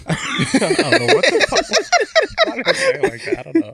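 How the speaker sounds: very high, helpless laughing speech; cracking up. Real, not acted. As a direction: squeal the words out through real laughter. Cracking up at own odd voice.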